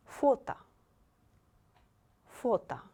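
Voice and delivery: Фото said fota, second о as а; foto with reduced o. Shown as a demonstration. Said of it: "second O you read like А".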